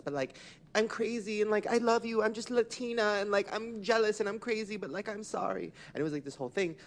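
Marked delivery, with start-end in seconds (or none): 0.0-5.7 high-pitched mock confession; gushing imitation of an apologetic friend, held high; Lele. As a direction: plead excuses in a high retold voice. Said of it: "And Lele's like".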